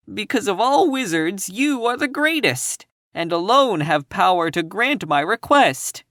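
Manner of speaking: high, flattering lion plea; pleading lion voice. A narrator's reading. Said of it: "answered the lion".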